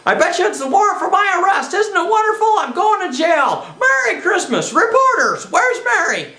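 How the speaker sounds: high, gleeful outburst; giddy elation. Acted. George greets the bad news of jail, giddy elation. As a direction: rattle off joyful cries, swooping high.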